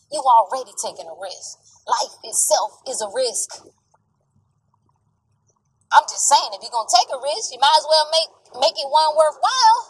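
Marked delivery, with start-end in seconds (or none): 5.9-9.8 louder, higher urging; forceful. Real, not acted. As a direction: push it home hard. Rising emphatic push.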